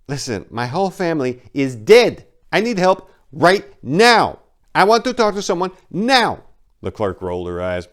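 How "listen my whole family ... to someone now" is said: high, loud pleading demand; desperate demand. Acted. Maria demanding help from the clerk, high and loud. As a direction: shout for help you need now.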